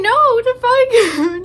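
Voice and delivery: high, loud mock outrage rising at the end; retold outraged disbelief. Outraged squeal correcting grandma about Chinese. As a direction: squeal it like you can't believe it. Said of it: "I'm like".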